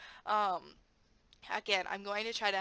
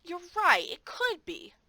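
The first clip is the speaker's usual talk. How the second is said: high, louder, slowing to a coaxing finish; gently steer a student toward the answer, higher and louder; encouraging teacher voice; 8 semitones higher, 7 dB louder, much slower than usual. Shown as a demonstration.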